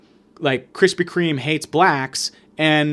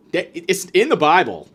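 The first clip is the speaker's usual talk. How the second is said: usual speaking voice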